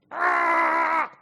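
a sudden loud, high cry of desperation; anguished cry. Acted. Preacher voicing Paul's plea to God, loud anguished cry. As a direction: let out a raw wail of pleading to God.

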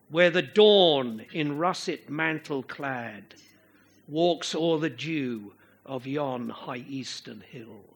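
slow verse reading with a beat on "dawn", "russet", "mantle", "clad"; iambic verse rhythm. Shown as a demonstration. Preacher quotes Shakespeare to show the metre, slow iambic recitation. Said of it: "You get that rhythm".

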